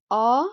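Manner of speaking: "a" as an unrounded "ah"; American open O. Shown as a demonstration. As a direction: sound out "a" as in American hot. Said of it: "in the American accent".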